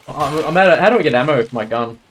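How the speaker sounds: usual speaking voice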